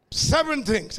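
ordinary delivery

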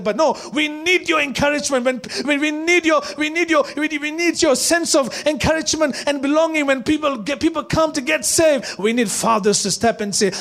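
loud, high, driving sermon; full-force preaching. Acted. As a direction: preach it to the whole church.

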